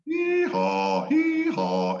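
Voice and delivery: a very high hee dropping to haw; up-and-down pitch. Shown as a demonstration. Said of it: "It's an up, down".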